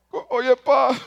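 voz aguda de niño lloroso fingido; llanto fingido. Retold or imitated. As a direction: finge llorar para ablandar al padre. Niño fingiendo llorar al teléfono. (niño fingiendo llanto, voz aguda)

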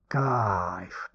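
low, soft, drawn-out groan of pleasure; blissful moan.

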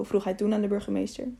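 usual speaking voice

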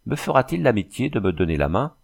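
usual speaking voice

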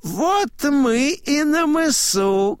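very high, slow announcement; high-voiced old man proclaiming; old man announcing. A narrator's reading. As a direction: draw it out in a high, ringing voice. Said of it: "говорит старик".

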